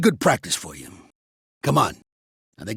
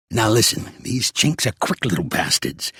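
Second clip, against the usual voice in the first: ordinary talk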